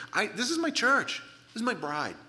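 high, forceful, possessive emphasis; protective insistence. Acted. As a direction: stake your claim on what is yours. Voicing God defending his church.

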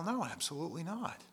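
ordinary talk, nothing marked

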